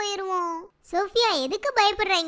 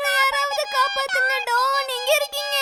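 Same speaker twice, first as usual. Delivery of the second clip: scared cry for help; 5 semitones higher than usual. Acted. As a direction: call out for rescue in a panic. Child cries for help.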